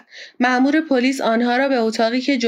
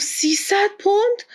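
much higher, drawn-out horrified question; 10 semitones higher, slower than usual. A narrator's reading. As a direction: stretch it upward in appalled disbelief. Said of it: "پاسپارتو با وحشت گفت".

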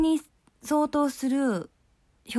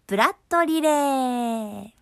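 segment title call; 3 semitones higher, slower than usual. Real, not acted. Host announcing the next corner to listeners, drawn-out title call. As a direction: call out the corner name, stretching the last syllable.

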